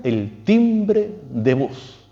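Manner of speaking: "el timbre de voz" drawn out very slowly, "timbre" rising; "timbre" lifted and held, every syllable slow; slow emphasis. Acted. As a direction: stretch "el timbre de voz" to linger on it. Key point of the sermon.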